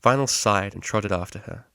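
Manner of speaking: plain reading voice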